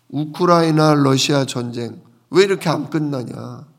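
ordinary talk

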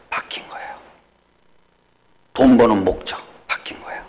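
a sudden whispered point; hushed whisper. Acted. Pastor to congregation, whispering the changed purpose. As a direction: drop to a whisper to drive it home.

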